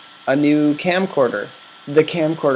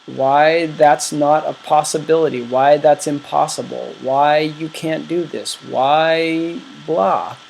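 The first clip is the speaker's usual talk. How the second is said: normal talking voice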